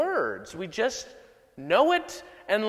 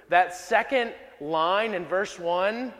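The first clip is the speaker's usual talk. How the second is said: ordinary talk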